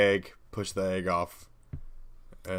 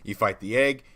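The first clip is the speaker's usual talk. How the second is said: ordinary talk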